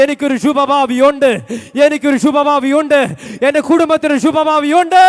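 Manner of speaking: loud, steady proclamation, each line hammered; fervent preaching. Real, not acted. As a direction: declare the promise over and over. Preaching at full force.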